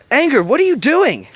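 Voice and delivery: high, loud alarmed question; worried. A narrator's reading. Joy confronts Anger, in a high loud worried cry. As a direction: call out in rising alarm. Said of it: "questioned with a worried tone".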